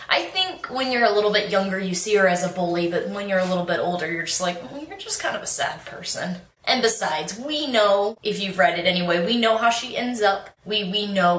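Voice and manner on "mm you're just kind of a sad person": soft, higher pitying aside; mock pity. Older reader sizing up the bully Clarice, mock pity. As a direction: lightly imitate gentle pity.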